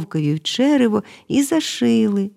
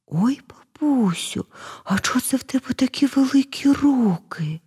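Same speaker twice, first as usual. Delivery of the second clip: put-on amazed girl; 6 semitones higher than usual. A narrator's reading. Girl to disguised wolf about huge hands, amazed. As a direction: draw out the oh, then ask high in wonder. Girl's questions grow more amazed.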